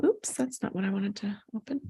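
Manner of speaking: ordinary talk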